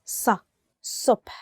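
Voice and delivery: صبح with a heavy, dark emphatic s; emphatic ṣad. Shown as a demonstration. Teacher demonstrating the correct emphatic ṣad. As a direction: say صبح with the ص pressed back in the mouth. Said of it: "is with a sa".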